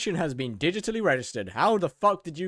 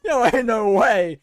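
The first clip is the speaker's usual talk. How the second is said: loud disbelief; 6 semitones higher, 9 dB louder, slower than usual. Real, not acted. Reacting to jail message.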